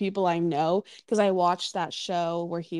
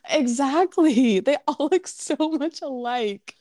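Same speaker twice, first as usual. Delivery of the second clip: laughing; 7 semitones higher, 5 dB louder, slower than usual. Real, not acted. Laughing agreement after joke.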